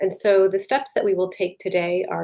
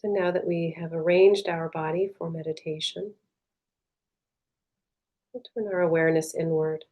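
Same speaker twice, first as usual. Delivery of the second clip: soft, unhurried meditation voice with long pauses; guided meditation; 4 dB quieter than usual. Real, not acted. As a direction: guide them gently inward, leaving space between phrases.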